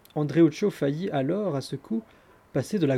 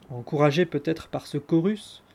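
ordinary delivery

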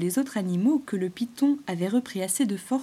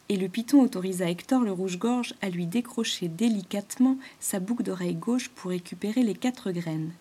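ordinary delivery

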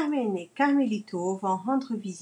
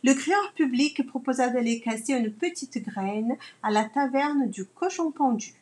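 ordinary delivery

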